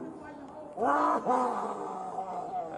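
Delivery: a long rising cry of pain; anguished cry. Real, not acted. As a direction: let out an anguished moan. Man pinned down, cry heard.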